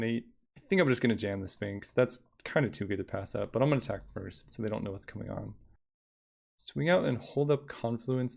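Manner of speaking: ordinary delivery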